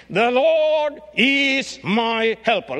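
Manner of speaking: high, slow, loud model phrase; slow, loud declaration. Shown as a demonstration. Preacher leading the church in confession, slow and loud. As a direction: lead the congregation, slowly. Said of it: "let's all together say".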